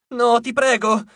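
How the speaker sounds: high, loud wail of please; moaning plea. A narrator's reading. Dwarf wails to Jason not to be sent away, high and loud. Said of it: "gemette Acmone".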